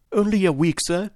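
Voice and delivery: high, despairing plea. A narrator's reading. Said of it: "in a despairing voice".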